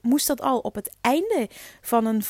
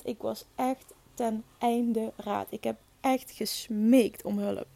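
very slow, heavy; slow, heavy recollection of despair; 4 dB quieter, slower than usual. Real, not acted. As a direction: lay each word down slowly. Recalling desperation.

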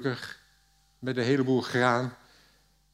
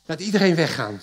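louder command to clear the room; called out; 4 semitones higher, 7 dB louder, faster than usual. A narrator's reading. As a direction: call it out so everyone hears. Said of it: "riep hij". (luid roepend bevel)

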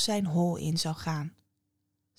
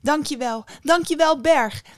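high, loud, exuberant calls; calling out thanks; 8 semitones higher, 7 dB louder than usual. A narrator's reading. Woman running down the mountain thanking everything, calling out. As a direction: shout gratitude while dashing along. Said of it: "riep ze".

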